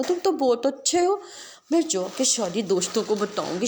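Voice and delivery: put-on high, lisping child voice; lisping child playing with the giraffe; little girl lisping. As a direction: babble happily like a small girl.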